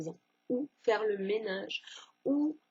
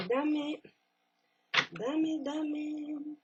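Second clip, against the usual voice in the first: speaking Spanish; 5 semitones higher, much slower than usual. Pretend. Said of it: "comment on dit conseil en espagnol".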